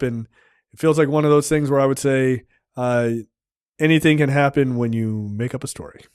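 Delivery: usual speaking voice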